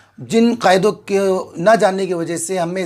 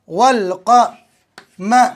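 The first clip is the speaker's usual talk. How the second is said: usual speaking voice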